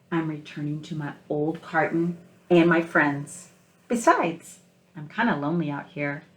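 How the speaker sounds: picture-book read-aloud, gentle and unhurried; story narration. Acted. Teacher reading picture book aloud.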